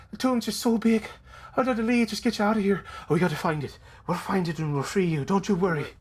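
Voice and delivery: put-on high Irish-accented character voice; Biff. Biff promising to free Starfallen, Irish accent. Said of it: "no, that's the voice".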